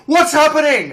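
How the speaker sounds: high loud mock alarm; shrill panicked question; squealed worried neighbour. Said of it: "be like".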